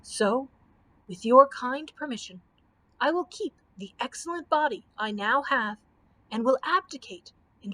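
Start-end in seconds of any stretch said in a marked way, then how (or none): none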